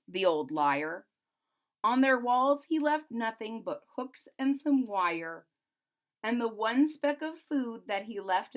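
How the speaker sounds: put-on rhythmic read-aloud to children; storybook narration. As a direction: lilt the rhyming verse. Reads the Grinch book aloud.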